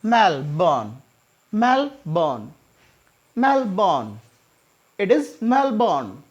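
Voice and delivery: मेलबर्न as mel-bn, r dropped; मेलबर्न, drop the r, mel-bun; r silent. Shown as a demonstration. Teacher showing the silent r in Melbourne. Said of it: "आर silent रहता है यहाँ पर".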